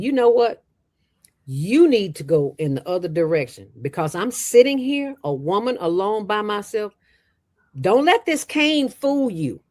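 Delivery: firm warning. Retold or imitated. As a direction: retell warning him off. Retold standoff with aggressive man.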